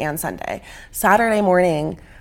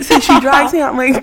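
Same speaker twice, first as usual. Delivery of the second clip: ordinary talk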